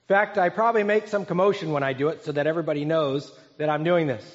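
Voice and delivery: ordinary delivery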